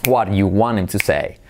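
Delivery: connected native question; linked with rhythm. Shown as a demonstration. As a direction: merge "want him" into "wanem". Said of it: "unir las palabras y acentuar".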